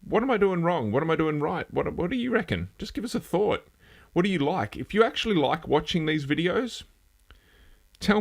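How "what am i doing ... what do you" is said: high, questioning mock viewer voice; lightly voiced curious commenter, pitched high. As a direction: pipe up as a puzzled viewer asking for feedback. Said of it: "put in the comments".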